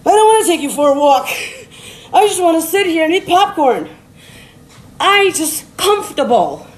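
mock reluctant whine; balking, put-upon protest; whiny refusal. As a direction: complain like someone who won't budge. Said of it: "And you're like".

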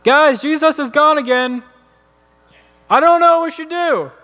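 high, exasperated acted yell; yelling down steps. Peter yells down to the crowd that Jesus is gone, high helpless yell. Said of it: "he yells down the steps".

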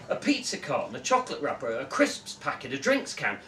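usual speaking voice

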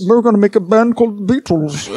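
terrible hammed-up Liverpudlian impression; bad Liverpool accent. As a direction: put on an awful Liverpool accent. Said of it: "No, Liverpool".

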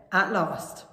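ordinary delivery, nothing marked

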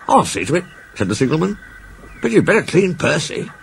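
startled signalman, high-pitched questions. A narrator's reading. Signalman figure in story.

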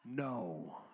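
very low, drawn-out no; deadpan refusal. Acted. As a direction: refuse dad-style, deep down in your register. Dad refuses the messy hug.

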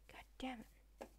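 breathy hushed swearing; whispered curse. Real, not acted. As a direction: curse under your breath.

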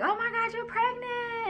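high, squealing with joy, voice cracking; excited squeal. Retold or imitated. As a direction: nurse squealing that the patient is pregnant, high and excited. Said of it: "like, getting excited".

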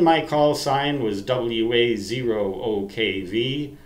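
ordinary talk

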